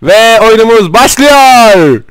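high drawn-out game start; slowed excited announcement. Acted. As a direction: stretch it out, high.

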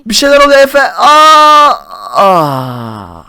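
loud panicked gasping cries; can't breathe. Acted. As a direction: choke and gasp for air. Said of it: "Nefes alamıyorum".

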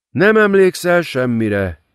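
charming smile. A narrator's reading. Lilith taunting Tess with a sweet smile. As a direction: purr it through a charming smile. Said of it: "mondta bűbájos mosoly alatt".

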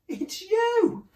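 very high, loud creature reply; high hooted answer; merry duckbill voice. A narrator's reading. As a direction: squeal it out high and amused. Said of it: "And they laughed and said".

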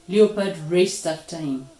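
ordinary delivery

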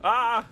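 high, loud "ah"; sudden shout; alarmed cry. Real, not acted. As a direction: yelp as if attacked. Cry during enemy attack.

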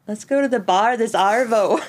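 shaky-aim Australian accent that lands near Irish; badly faked Australian accent. Shown as a demonstration. Friend asked to say something Australian, badly done Australian accent. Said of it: "ask me to say something with an Australian accent".

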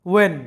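when said "wen", with no h; silent h. Shown as a demonstration. Teacher repeats when with the h silent. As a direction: when begins on w only. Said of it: "তখন silent থাকে".

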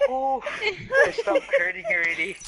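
shouting through a laughing fit; laughter spilling out, voice jumping up. Real, not acted. Laughing fit during game.

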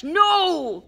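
loud, high drawn-out growl; angry bellowed objection; Hagrid, growling. Acted. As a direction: roar a refusal. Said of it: "growled Hagrid".

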